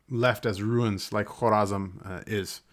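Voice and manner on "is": ordinary talk, nothing marked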